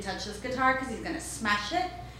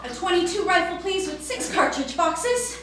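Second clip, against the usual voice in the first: put-on higher, deliberate kid's demand; boy demanding; 8 semitones higher than usual. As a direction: spell out exactly what he wants.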